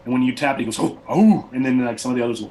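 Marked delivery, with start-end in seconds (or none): 0.8-1.5 loud, high surprised oh; startled by the hollow ring. Acted. Barbarian hearing the shield tapped, loud surprised oh.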